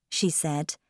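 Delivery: ordinary delivery, nothing marked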